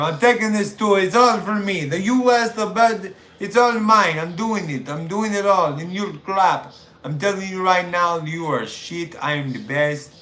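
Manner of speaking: put-on boastful wrestler in a botched German-Australian accent; bad foreign accent. Foreign champion claiming every title, in a bad muddled accent. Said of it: "I suck at accents".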